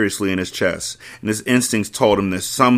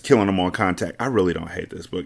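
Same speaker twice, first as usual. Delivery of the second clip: ordinary delivery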